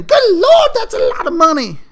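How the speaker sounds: high, loud squealed disbelief; mock shocked fan. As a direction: play an outraged fan gasping at the salary. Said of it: "people are probably out there saying".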